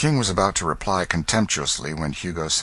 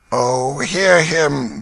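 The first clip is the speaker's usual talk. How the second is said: very slow, hammed-up moan; mock groan; 8 semitones higher, 5 dB louder, much slower than usual. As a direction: play the wounded invalid. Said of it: "groaned Hugo".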